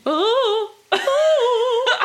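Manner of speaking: high, loud gasping oh oh; mock swoon. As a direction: swoon like a fan overcome. Mock emotional gasps.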